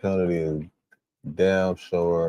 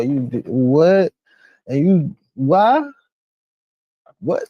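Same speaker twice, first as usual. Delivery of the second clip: slow, higher, louder accusing questions; outraged questioning; 8 semitones higher, 5 dB louder, slower than usual. Real, not acted. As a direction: demand an answer in outrage. Confronting the absent criminal.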